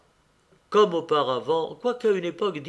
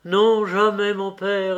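repeated exclamation; 5 semitones higher, 5 dB louder than usual. A narrator's reading. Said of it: "répétèrent-ils tous".

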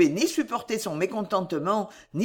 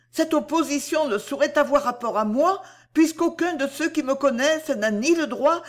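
higher, troubled lady; Cécile, agitated; 6 semitones higher, 3 dB louder than usual. Acted. Cécile questions Delville about the mysterious voice, higher. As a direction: press the question anxiously.

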